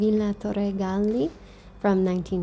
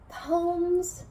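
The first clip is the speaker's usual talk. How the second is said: "Poems" held high and drawn out; playful announcement; 9 semitones higher than usual. Real, not acted. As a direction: announce "Poems" in a raised, stretched voice. Playfully announces the segment, then repeats it.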